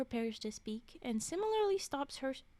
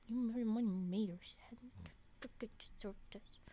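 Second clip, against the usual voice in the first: whispered aside; 3 semitones lower, 7 dB quieter than usual. Real, not acted. Streamer whispering to chat about the scene. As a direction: hush an aside to the audience.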